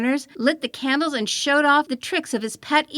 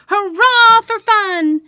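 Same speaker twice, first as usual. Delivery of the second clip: loud, much higher, drawn-out cheer; shouting; 8 semitones higher, 10 dB louder, much slower than usual. A narrator's reading. The rowdy children run riot through the rooms, shouting loud. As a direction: whoop it like wild kids let loose. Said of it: "while they shouted".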